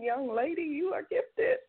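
excited praise. Real, not acted.

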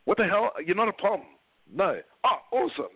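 mock astonished client hearing an Aussie accent; surprised client. Client on the phone reacts to an Aussie recruiter, mock amazed and high. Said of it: "And they're like".

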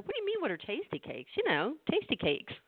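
high, fast disbelieving retort; can't believe they don't know Tastykakes; mock-astonished, high and fast; incredulous.

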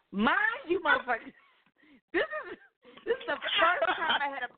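sudden high outburst; high exclaim. Real, not acted. Angry aside to listeners.

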